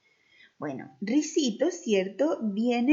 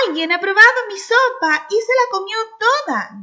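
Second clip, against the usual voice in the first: much higher and louder, small cub; little bear's tiny voice; 11 semitones higher, 11 dB louder than usual. Acted. As the little bear finding his soup gone, tiny high voice. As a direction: squeak it like a tiny upset cub. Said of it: "dijo el osito con voz chiquitita".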